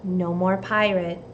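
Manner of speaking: ordinary delivery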